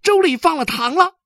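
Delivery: put-on very high, loud child's cry of discovery; thrilled boy yelling; sudden excited shout. A narrator's reading. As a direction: burst out shouting with delight. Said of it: "他突然叫了起来".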